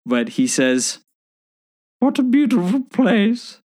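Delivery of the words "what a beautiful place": much higher, slow squeaky elf voice rising to a shout; Dobby accent. Acted. Friend asked for accent, Dobby's last line in a high squeak. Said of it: "Accent, please".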